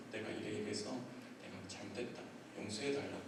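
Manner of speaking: very soft, low acted apology; apologizing. Husband apologizes to wife, very quietly contrite. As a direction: murmur a subdued admission of fault. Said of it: "사죄를 했죠".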